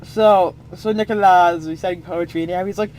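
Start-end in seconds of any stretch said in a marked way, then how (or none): none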